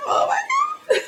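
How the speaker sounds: very high imitated fangirl squeal; starstruck squeal. Fan retells idol looking straight at her, starstruck squeal. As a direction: squeak it out, overwhelmed, breaking into laughter.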